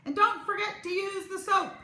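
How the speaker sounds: high, loud call from afar. Acted. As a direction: call it down the hall. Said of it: "she called after us".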